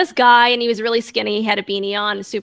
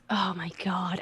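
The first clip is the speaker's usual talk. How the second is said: whisper; 3 semitones lower, 9 dB quieter than usual. Real, not acted. Whispered aside.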